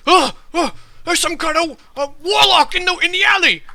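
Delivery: loud, high-pitched shout of alarm; acted townsperson yelling in alarm; alarmed onlooker. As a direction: cry out a warning to the whole street. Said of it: "one of the voice, uh, is like".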